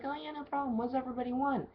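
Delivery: high, eager bubbly offer; eager service voice. Retold or imitated. Bartender eagerly taking the celebrity's order, high bubbly voice. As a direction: sound thrilled to serve. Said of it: "I was like".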